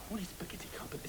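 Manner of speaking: fast, voiceless whisper; whispered aside. Acted. Waiter secretly asking staff what it is, quick whisper. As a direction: hiss a hurried question under the breath. Clueless aside behind customer's back.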